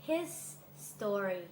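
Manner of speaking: his, pause, then STO-ry in full; separated his story. Shown as a demonstration. Teacher demonstrates the second word, his story split apart. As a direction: say his story as two distinct words. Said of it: "คำที่สองมีสามพยางค์".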